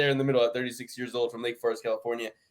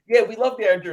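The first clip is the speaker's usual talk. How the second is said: higher, louder mocking gush; mock fan gush; 9 semitones higher, 6 dB louder than usual. Mocking friend's sentimental pick.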